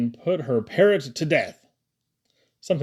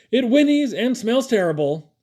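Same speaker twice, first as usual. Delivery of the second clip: high, loud, urgent report; Caleb; 10 semitones higher, 5 dB louder than usual. A narrator's reading. Caleb informing Papa of the creature, urgent and high. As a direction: blurt the alarming news in a raised pitch.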